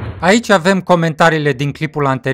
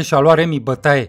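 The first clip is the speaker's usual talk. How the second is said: ordinary delivery, nothing marked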